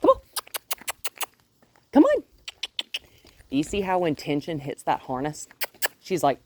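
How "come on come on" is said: very high, much louder call. Real, not acted. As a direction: call her across the field. Calling dog.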